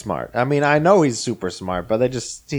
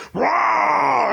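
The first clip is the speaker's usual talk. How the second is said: monster roar. Retold or imitated. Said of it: "the Hulk to just get up and go".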